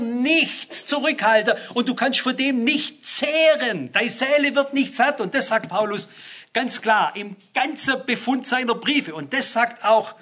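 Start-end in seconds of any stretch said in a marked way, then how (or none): none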